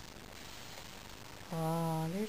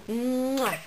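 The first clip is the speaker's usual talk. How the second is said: put-on baby kisses. Shown as a demonstration. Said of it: "blow kisses?".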